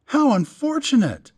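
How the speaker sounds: high exclaimed dismay; feigned shocked exclamation; exclaiming. A narrator's reading. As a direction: cry it out in surprise. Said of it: "Bookstrom exclaimed".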